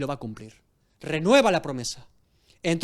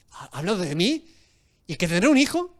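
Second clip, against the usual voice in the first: incredulous surprise; 3 dB louder than usual. Acted. Sara overhearing the promise.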